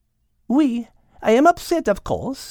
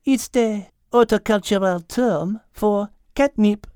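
almost matter-of-fact; 10 semitones higher than usual. A narrator's reading. Mademoiselle explains catnip to Al, almost matter-of-fact. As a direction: explain it evenly in a French accent. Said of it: "in almost a matter-of-fact tone".

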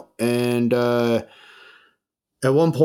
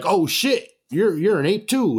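higher-pitched, delighted discovery; retold excited recognition; 8 semitones higher than usual. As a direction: act out spotting one of your own. DJ discovers a fellow ape owner.

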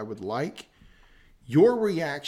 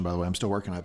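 ordinary delivery

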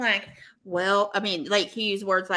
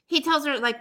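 ordinary talk, nothing marked

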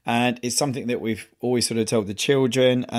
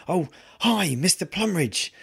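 nurse's bright greeting; 7 semitones higher than usual. Retold or imitated. Nurse voiced in retold story.

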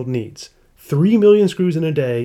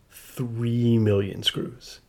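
slow hushed reveal; 2 semitones lower, 6 dB quieter, much slower than usual. Acted. Punchline of movie quote parody.

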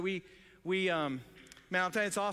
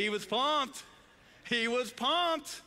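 excited; 6 semitones higher, slower than usual. Real, not acted. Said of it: "He was pumped, baby".

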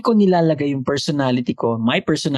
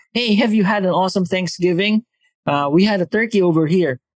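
higher, put-on American small-talk accent; fake American accent; 4 semitones higher than usual. Asking a client about Thanksgiving, mock American accent. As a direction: overdo the friendly American tone. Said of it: "I would always put up a fake accent".

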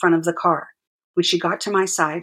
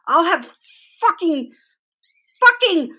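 higher and higher, choking on the word; sputtering rage; 12 semitones higher, 4 dB louder than usual. A narrator's reading. Furious mother sputtering threats at the police, rising shriek. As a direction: rage so hard the sentence won't come. Raging mother loses her words.

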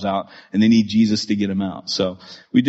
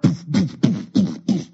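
paintball poofs; 4 semitones higher, slower than usual. Retold or imitated. Paintball art being shot.

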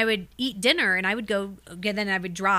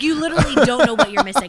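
louder insistence; 5 semitones higher, 9 dB louder, faster than usual. Real, not acted. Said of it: "louder repeat".

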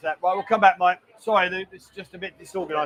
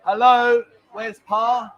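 loud, raised call; 2 semitones higher, 6 dB louder, much slower than usual. Real, not acted. Host calling for Pa during a disorganised show, calling out. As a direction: call out for someone who's missing.

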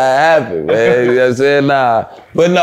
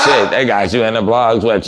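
ordinary talk, nothing marked

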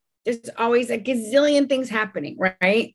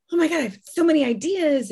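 excited gush; 6 semitones higher, faster than usual. Acted. Acts herself excited on a call.

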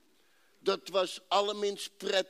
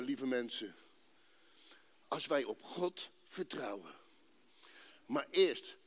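ordinary delivery

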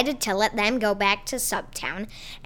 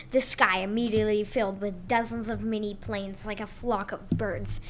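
ordinary delivery, nothing marked

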